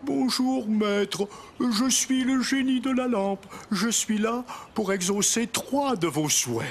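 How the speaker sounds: high booming genie of the lamp. Acted. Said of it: "celui qui dit".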